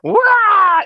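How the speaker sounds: high, loud froggy scream imitation; Kermit scream. Describing the singer's Kermit-like scream, mock. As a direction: squawk like Kermit the Frog. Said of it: "that weird, like, Kermit the Frog kind of scream".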